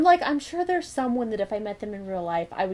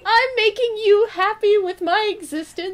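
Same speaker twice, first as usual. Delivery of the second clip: high, loud heartfelt exclamation; overjoyed gushing; 7 semitones higher, 5 dB louder than usual. Retold or imitated. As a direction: gush with delight, pitched way up. Voicing own delight at being recognized.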